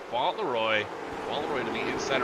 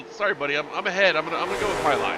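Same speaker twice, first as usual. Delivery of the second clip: quick, loud, cocky driver excuse; driver's voice; 5 semitones higher, 5 dB louder, faster than usual. Retold or imitated. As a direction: brush it off like a racer.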